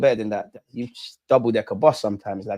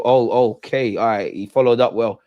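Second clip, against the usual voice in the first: usual speaking voice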